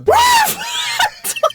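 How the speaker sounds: high excited animal yelp; happy dog yelp. Pretend. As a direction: yelp like a lottery-winning dog. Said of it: "je me mets dans le perso".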